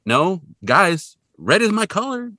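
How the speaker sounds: high, loud mock plea; protesting plea. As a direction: call after friends who walk off.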